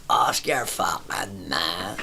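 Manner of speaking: low, soft, very slow comeback; long, lazy drawl, dropped low; drawn-out retort. Retold or imitated. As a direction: imitate a drinker stretching out every word. Drinker's lame last retort, retold.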